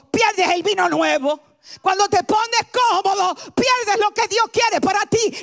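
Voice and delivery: full-force preaching. Acted. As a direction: preach it out to the whole room, full throttle. (predicación a todo pulmón, gritando)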